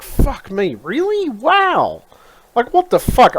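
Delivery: high-pitched mock amazement; sarcastic, stunned outburst; incredulous. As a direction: swoop way up in exasperated disbelief. Acts out reaction to job stupidity.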